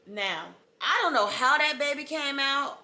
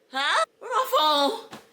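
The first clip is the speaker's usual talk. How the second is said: confused panic; 10 semitones higher, 5 dB louder, slower than usual. Retold or imitated.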